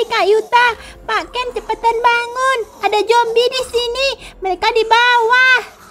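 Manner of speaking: put-on high, urgent child's voice calling for help; frightened little girl. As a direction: wake everyone up, scared and breathless. Child character Mio panics.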